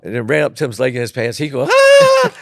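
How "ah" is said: high, loud shriek; hammed-up falsetto ah; mock scream. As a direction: scream as if a mouse just ran up your leg. Tim's scream as the mouse runs up.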